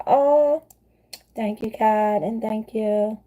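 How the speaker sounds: high-pitched gush of thanks; delighted thanks. Real, not acted. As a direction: squeal your thanks to a viewer. Reading viewer's kind comment live.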